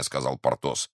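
ordinary delivery

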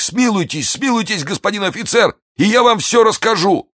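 high, desperate pleading; wounded man begging for his life. Acted. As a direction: beg frantically, voice up high. Wounded bandit begs for mercy.

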